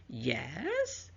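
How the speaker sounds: hesitant, lifting into a question; uncertain. Shown as a demonstration. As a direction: answer as if asking. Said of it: "you're not sure".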